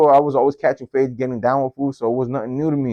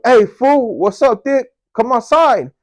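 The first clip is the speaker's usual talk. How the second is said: high, louder call through a window; 12 semitones higher, 5 dB louder, slower than usual. Retold or imitated. Outside his window calling his friend out, loud and high.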